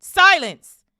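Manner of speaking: explosive high yell; sudden, very loud outburst. A narrator's reading. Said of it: "yelled Uncle Vernon".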